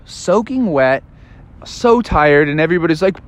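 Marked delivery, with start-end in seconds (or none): none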